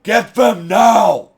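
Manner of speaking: imitating Kingpin's loud, high shout; slow, stretched mock shout of an order; Kingpin yelling. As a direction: bark the order like a furious crime boss. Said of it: "and Kingpin's like".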